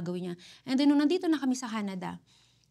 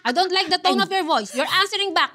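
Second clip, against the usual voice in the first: higher and louder, a mother's firm telling-off; mother correcting her son's tone, stern and raised; stern scolding; 6 semitones higher, 7 dB louder than usual. Retold or imitated. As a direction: scold him for talking back.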